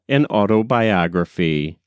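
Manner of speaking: ordinary delivery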